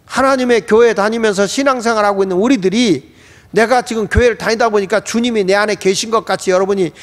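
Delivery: ordinary talk, nothing marked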